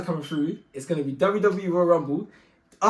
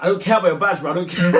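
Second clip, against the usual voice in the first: louder, an angry brush-off; 3 semitones higher, 9 dB louder, faster than usual. Retold or imitated. The friend refusing the fake officer's search, angry. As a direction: wave off the badge with a heated voice. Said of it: "This guy was angry".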